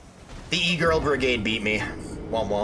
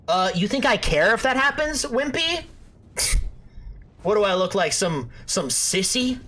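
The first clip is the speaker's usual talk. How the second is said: cocky trash talk; 5 semitones higher than usual. Acted. Trash-talking boastful persona.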